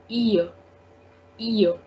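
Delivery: "ear" said as ee-yuh, no L; French "ille" sound. Shown as a demonstration. Teacher showing the E double L E sound, "ear" as ee-yuh. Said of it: "so the sound will become ear".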